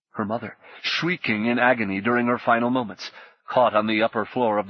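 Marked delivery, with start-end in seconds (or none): none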